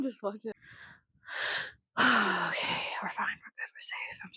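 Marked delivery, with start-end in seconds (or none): 2.0-4.3 soft unvoiced self-soothing whisper. Real, not acted. Calming herself after a laughing fit, a soft whisper. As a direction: whisper yourself calm.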